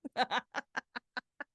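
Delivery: soft laughing fit; amused soft laugh at dad's gift; breathy laugh. Real, not acted. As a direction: laugh quietly at the memory.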